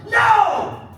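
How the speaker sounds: high, loud shouted demo; theatrical high-pitched yell of refusal. Shown as a demonstration. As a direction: yell a huge NO for the crowd to copy. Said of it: "I want you guys to yell out".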